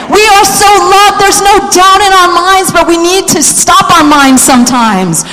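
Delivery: rally the room like a revival preacher; preaching at full force. Acted. Pastor stirring the congregation, preaching at full force.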